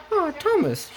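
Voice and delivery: high falsetto engine character; Daisy. Acted. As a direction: greet in a light, high-pitched voice. Daisy greets Thomas.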